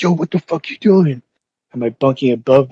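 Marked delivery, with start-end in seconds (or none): 0.0-1.2 higher-pitched, alarmed mock yell; retold yell. Inmate yelling at man waking him up, retold yell. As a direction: yell it as you retell it. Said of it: "I, I yelled".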